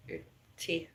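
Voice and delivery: ordinary talk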